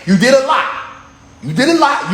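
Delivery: ordinary talk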